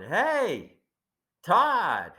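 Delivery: high, drawn-out call from afar; imitated yell. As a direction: holler a name like a stranger hailing someone. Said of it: "some guy starts yelling".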